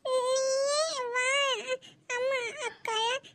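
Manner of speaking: put-on very slow, tearful child's plea; whining through tears. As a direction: drag the words out between sobs. Child whines to her aunt.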